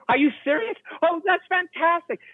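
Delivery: high, thrilled mock enthusiasm; excited gush. Producer gushing over the funny movie, excited. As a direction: gush like a delighted studio boss.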